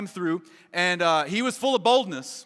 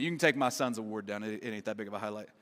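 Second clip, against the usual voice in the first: muttered aside; 11 semitones lower, 9 dB quieter than usual. Real, not acted. Aside to the slide operator.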